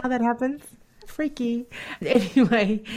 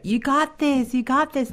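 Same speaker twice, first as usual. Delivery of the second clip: higher, peppy encouragement; mock cheering crowd; 6 semitones higher than usual. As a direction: cheer on a runner, a bit hammed up. Marathon spectators cheering.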